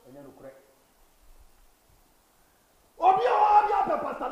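high, very loud preacher's shout; preaching at full force. Acted. Preacher rousing the church, very loud and high. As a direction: hammer each line at the congregation.

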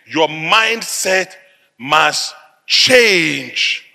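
very slow, drawn-out delivery; deliberate pulpit pronouncement; solemn exhortation. Real, not acted. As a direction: stretch each word for weight, pausing before "must change". Preacher's slow first statement.

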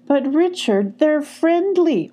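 high, drawn-out protest; arguing. A narrator's reading. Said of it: "argued Jake".

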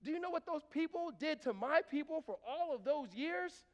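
loud, high, slow outraged cry; outraged Jonah. Acted. Preacher voicing Jonah, outraged and loud. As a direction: voice Jonah's bitter grievance.

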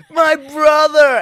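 very high, loud mock-drunk cry; exaggerated squeal of a drunken reveal. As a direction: belt it out slowly as a drunk. Repeats the drunk reveal, louder.

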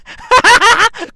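loud, high burst of laughter; real high cackling laugh. Real, not acted. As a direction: crack up at your own joke. Laughs at own wordplay.

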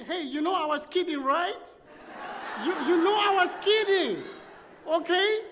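to the clerk he was rude to, suddenly placating and laughing; changed tone. Retold or imitated. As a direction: backpedal with a nervous laugh, all jokes now. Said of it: "I changed my tone".

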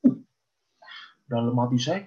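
usual speaking voice

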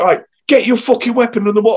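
hammed-up corporal yelling an order; shouted corporal order. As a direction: corporal ordering rifles into the water. Corporal voiced ordering weapons in.

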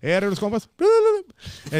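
loud yell into a squeaky high bla bla; catchphrase yell on request. Shown as a demonstration. Doing his viral catchphrase when the host asks. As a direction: belt it out like a battle cry. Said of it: "A ver, hazla, hazla".

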